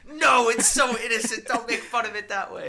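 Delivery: high loud whiny mock voice; mock whiny protest. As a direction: whine it like a pouting fan. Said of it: "you're like".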